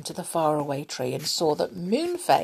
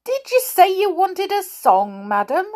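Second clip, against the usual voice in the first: high, loud offer to oblige; obliging saucepan man; 13 semitones higher, 6 dB louder than usual. A narrator's reading. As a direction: ask obligingly, pitched up and loud. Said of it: "said the saucepan man obligingly".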